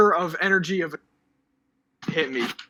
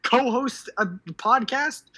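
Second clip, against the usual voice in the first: gushing; 8 semitones higher, slower than usual. Real, not acted. Gushing over chat compliment.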